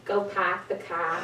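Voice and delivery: pahk the cah, no r sounds; Boston accent. Shown as a demonstration. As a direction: say it again the Boston way. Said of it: "now listen".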